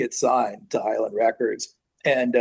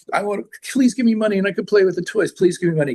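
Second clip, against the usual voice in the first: put-on high, begging kid voice; pleading child; 9 semitones higher, 3 dB louder, faster than usual. Kid to mom or dad for toy money, mock pleading. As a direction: imitate a kid pestering parents for cash.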